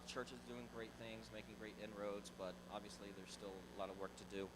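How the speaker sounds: usual speaking voice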